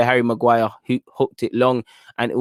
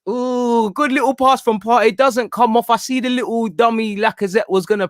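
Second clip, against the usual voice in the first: excited; 8 semitones higher than usual. Real, not acted. Excited fan reacts to pass.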